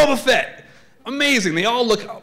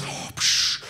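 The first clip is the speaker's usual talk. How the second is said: Darth Vader; 6 dB quieter than usual. Acted. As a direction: breathe heavily through a villain's mask. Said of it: "Then he goes".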